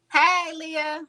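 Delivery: high-pitched call for attention. Real, not acted. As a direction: call someone over by name. Calls out to Leah.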